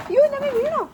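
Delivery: sudden high yelp of alarm; startled cry. Real, not acted. Mother on the bicycle, startled she is falling, high alarmed cry. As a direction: blurt it out in a fright. Startled while cycling, cries out.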